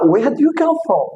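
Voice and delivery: curious outsider asking. Retold or imitated. Host voicing people who ask the Kenyan guest, high mock-curious question. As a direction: play a stranger quizzing a foreigner. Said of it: "They are like, you know".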